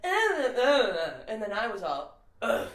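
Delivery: lightly imitated back-and-forth of wordless grunts; Adam Sandler bit. Retelling Sandler's SNL sketch to a friend, imitated grunting dialogue. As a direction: retell a quarrel in grunts and whines, swelling. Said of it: "something like this".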